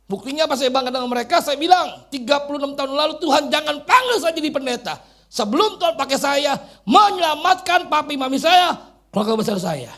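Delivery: high, loud testimony from the pulpit; impassioned preaching. Real, not acted. Preacher retelling his plea for his parents' salvation, impassioned preaching.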